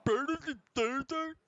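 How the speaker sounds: high mocking goblin chant; silly high goblin dur-dur chant; imitating the goblin. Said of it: "What the fuck was that goblin back there?".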